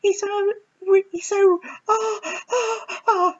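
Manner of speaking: very high, squealing swoon ending in a mock cry; swooning fangirl. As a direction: gush like a smitten teenage girl at a concert. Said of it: "I'm not a girl going".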